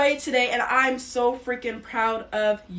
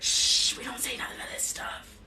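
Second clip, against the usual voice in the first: much softer mock secretive hush; hushing whisper; 3 semitones higher, 5 dB quieter than usual. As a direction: shush like a gatekeeper keeping secrets. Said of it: "and be like".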